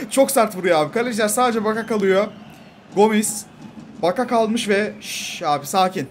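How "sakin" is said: ordinary delivery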